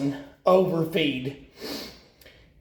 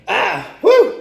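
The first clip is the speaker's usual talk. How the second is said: whoop; 9 dB louder than usual. Real, not acted. Shifting in the tank.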